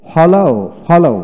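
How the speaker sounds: ফলাও slow, high and loud, twice; model reading. Shown as a demonstration. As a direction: drill ফলাও, stretching each syllable. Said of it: "আমি এখন পড়ে শোনাই".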